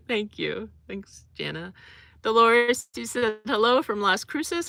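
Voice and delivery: ordinary talk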